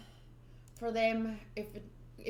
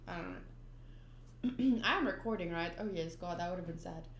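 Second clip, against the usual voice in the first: usual speaking voice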